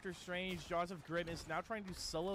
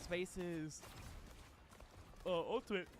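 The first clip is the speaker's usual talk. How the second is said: talking through a yawn; slow, yawning words; slower than usual. Real, not acted. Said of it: "I don't know why I'm yawning".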